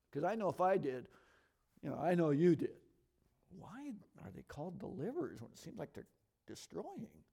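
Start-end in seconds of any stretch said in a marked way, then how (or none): none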